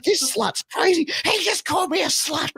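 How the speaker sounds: very high squawking imitation of a quarrel; hammed-up indignation, voice way up; mock incredulous outrage. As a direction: protest in a high, outraged mimicry. Acted backstage quarrel.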